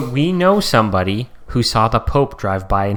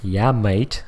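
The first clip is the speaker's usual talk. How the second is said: a hint of the mock Australian accent; brief Australian accent reprise. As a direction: toss off a light Aussie "mate". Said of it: "good Australian accent".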